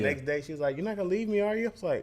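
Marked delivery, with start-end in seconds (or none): 0.8-1.7 worried little daughter. Retold or imitated. Voicing worried small daughter.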